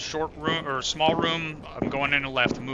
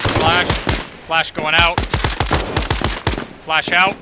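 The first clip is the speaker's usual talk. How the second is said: grenade warning yell; 3 semitones higher, 8 dB louder, slower than usual. Real, not acted. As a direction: shout it so the team takes cover. Throwing a flashbang.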